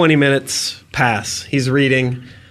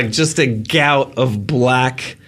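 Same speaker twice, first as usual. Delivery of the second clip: ordinary talk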